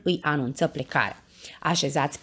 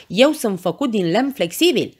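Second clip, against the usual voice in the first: loud, raised call to the crowd; eager shout; 4 semitones higher, 6 dB louder than usual. A narrator's reading. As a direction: proclaim it urgently over the noise. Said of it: "strigă Pancinelă". (strigăt plin de avânt)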